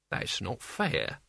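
slow, soft, low whine. A narrator's reading. Albert protests at Mort's threat, whining. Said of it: "Now it was a whine".

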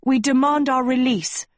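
high, forceful demand; crying out. Acted. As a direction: cry it out in protest. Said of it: "Agatha cried".

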